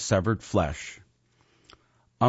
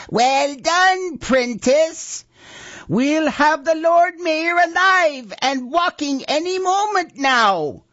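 high, loud gloating witch; triumphant witch; 5 dB louder, slower than usual. A narrator's reading. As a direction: crow over the deed. The Witch of Mud Bend speaks.

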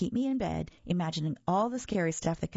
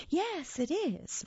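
much higher, slow, silky and prim; a well-groomed monster showing off politely; 12 semitones higher, slower than usual. A narrator's reading. Ralph offering his arm to the boy, high and silky. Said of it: "Ralph replied".

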